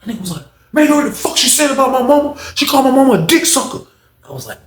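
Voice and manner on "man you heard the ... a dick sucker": very high, indignant mock protest; acted outrage. Inmate outraged over insult to his mama, indignant.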